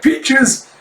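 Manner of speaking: high, loud questioning repeat; acted startled query; surprised echo. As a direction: echo the word in high disbelief. Master echoes the news.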